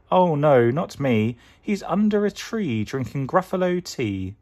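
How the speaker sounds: high, loud sly fox; a crafty fox brushing her off. Acted. Fox answers the Gruffalo's child, high and sly. Fox denies being the mouse.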